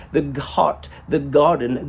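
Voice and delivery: ordinary delivery, nothing marked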